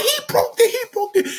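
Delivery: squeaky panicked sibling; high-pitched whining accusation; high whiny brother voice. Retold or imitated.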